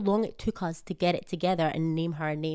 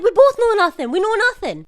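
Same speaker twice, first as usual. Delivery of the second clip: high, loud insisting; insisting to husband, high and loud; mock exasperated shout; 12 semitones higher, 10 dB louder than usual. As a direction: shout it back at him. Retold argument climax.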